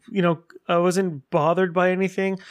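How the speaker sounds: normal talking voice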